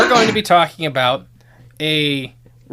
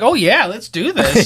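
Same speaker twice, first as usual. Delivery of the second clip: higher, drawn-out mock enthusiasm; retold eager yes; 7 semitones higher, 3 dB louder, slower than usual. Co-host retells agreeing to the podcast, eager and drawn out. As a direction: replay an eager yes, stretched out and lifted.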